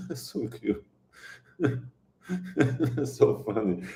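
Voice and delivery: real amused laughter breaking up the words; tickled, softly laughing as you speak. Real, not acted. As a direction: talk through soft giggles. Giggling at a chat message.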